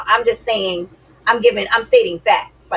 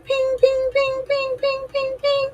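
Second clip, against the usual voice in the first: much higher, tiny chiming pings, fading; ping sound effect; slower than usual. Retold or imitated. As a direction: imitate little bells going off in his head. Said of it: "endorphins had to be like".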